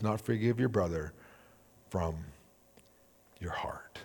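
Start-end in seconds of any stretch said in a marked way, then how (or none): 1.9-4.0 hushed ending. Real, not acted.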